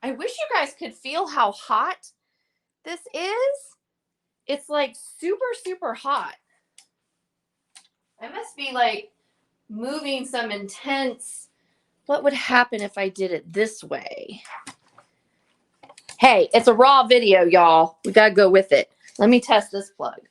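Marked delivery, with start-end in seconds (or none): 2.9-6.4 excited. Real, not acted. Feeling how hot the lamp is. 16.2-20.2 loud, bright laughing call to viewers; loud and cheerful. Real, not acted. Laughing off the technical mishap.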